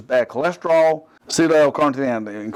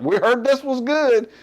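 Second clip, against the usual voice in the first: mock naive seller; 9 semitones higher than usual. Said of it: "It was just like".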